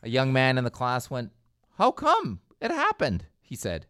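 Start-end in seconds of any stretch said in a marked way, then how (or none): 1.8-3.3 much higher, stunned disbelief imitating a student; shocked question. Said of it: "He was shocked".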